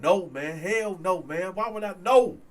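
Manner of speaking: retold protest, rising high and loud by the end; indignant denial building in pitch; emphatic denial. Accused man to his friend, emphatic denial.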